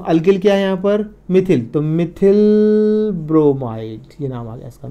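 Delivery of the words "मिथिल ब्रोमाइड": drawn-out syllables with a gap between words; slow dictation. Shown as a demonstration. Teacher writing the common name for students, slow dictation. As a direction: pace the name to the pen.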